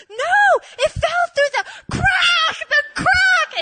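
very high, loud, half crying; desperate yelling. Retold or imitated. Correcting her friend, a high desperate shout. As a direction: insist on the crack.

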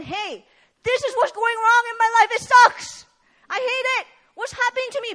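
high, loud anguished outburst; desperate complaint. Acted. Preacher imagining the woman's cry, high and loud. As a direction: let the frustration burst out high and loud.

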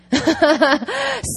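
real, rapid cackling chuckle; hearty laugh. Real, not acted. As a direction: laugh out loud at your own point. Laughs at own remark.

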